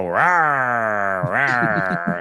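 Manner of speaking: low mock growl; raccoon growl. Teasing the viewer's dog on the show with a low mock growl. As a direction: growl lightly like a puppet raccoon. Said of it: "and I go".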